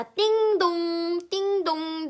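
slow, sing-song ding-dong chime; voiced doorbell ringing; doorbell imitation.

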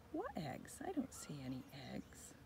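put-on hushed aside to the children; conspiratorial storytime whisper. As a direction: whisper it like a secret.

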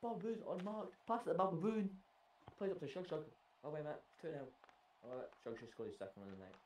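rising live commentary call; play-by-play. Acted. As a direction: call the action like a caster.

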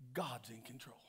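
soft whispered truth. Acted. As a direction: breathe it out as a secret. Key point dropped to whisper.

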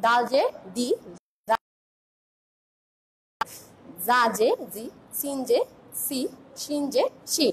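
each letter with জে chanted to its short i sound; syllable drill. Shown as a demonstration. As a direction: recite the kasra line steadily. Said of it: "এখন জে দিয়ে যদি করি".

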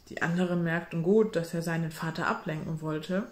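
usual speaking voice

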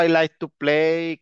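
drawn out slowly; slow teacher prompt; slow model phrase. Shown as a demonstration.

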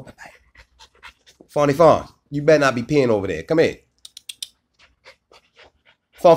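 sharp call to a peeing dog; scolding the dog. Real, not acted. Owner scolds dog peeing over there, loud.

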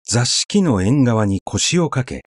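usual speaking voice

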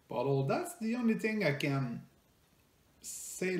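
ordinary talk, nothing marked

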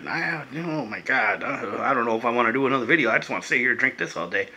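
a touch overcome with pleasure; quiet, mock-overwhelmed sigh of content; emotional. Real, not acted. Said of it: "got a little, little emotional right there".

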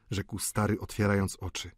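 usual speaking voice

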